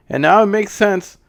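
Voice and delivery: ordinary talk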